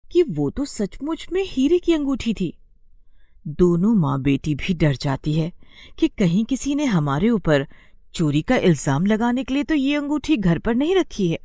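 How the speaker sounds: ordinary delivery, nothing marked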